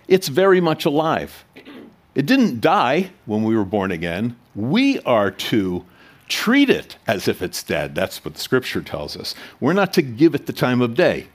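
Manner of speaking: normal talking voice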